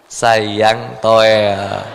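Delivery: soft, low sweet-talk to a sulking wife; coaxing husband. Retold or imitated. (bujukan lembut suami)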